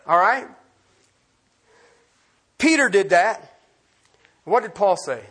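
ordinary talk, nothing marked

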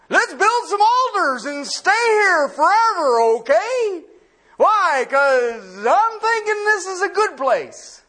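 laughing through "Let's build some altars and stay here forever"; chuckling disciple impression; laughing Peter. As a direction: play Peter, eager and amused. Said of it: "What would you have done?".

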